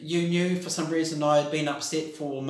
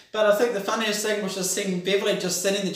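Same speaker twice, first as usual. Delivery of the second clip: ordinary talk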